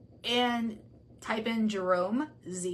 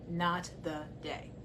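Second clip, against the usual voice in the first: quiet slow repeat; 4 semitones lower, 6 dB quieter, slower than usual. Shown as a demonstration. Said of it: "I'm gonna repeat this again".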